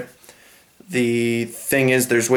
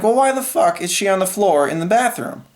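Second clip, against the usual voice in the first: higher, incredulous retold rant at the stepdad; pitched-up angry why; exasperated outburst; 7 semitones higher than usual. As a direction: demand an answer in disbelief.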